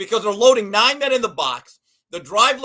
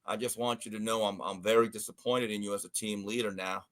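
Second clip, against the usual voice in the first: disappointed coach; 10 semitones lower, 5 dB quieter than usual. Retold or imitated. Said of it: "and he goes".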